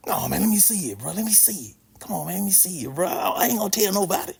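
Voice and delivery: hushed, wheedling classmate; soft, mock-conspiratorial begging; imitated furtive pleading. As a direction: coax him under your breath, keeping it secret. Class clown secretly begging to see.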